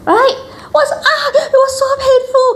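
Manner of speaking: very high, hammed-up wail; comic high-pitched agony; acted painful cry. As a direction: cry it out as a mock yelp of pain. Acting out remembered breastfeeding pain.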